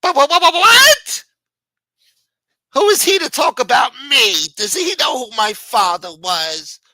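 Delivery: high furious shouting, rising to a shriek on what; outraged yell. Acted. Ex-friend on the pretend call, outraged high yell. Outraged side of acted quarrel.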